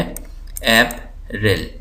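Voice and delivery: April slowly as AP, ril; syllable by syllable. Shown as a demonstration. Teacher showing two syllables, April slowly split. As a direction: leave a gap inside April. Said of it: "इसके अंदर दो syllable हैं".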